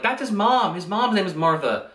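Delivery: a lightly voiced Lois, pleading; urgent, then settling. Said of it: "Lois is like".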